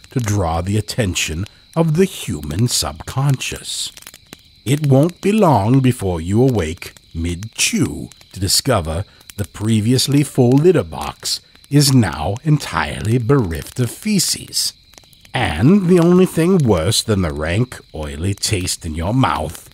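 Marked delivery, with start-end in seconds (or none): none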